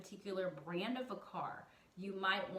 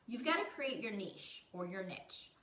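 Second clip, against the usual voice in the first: normal talking voice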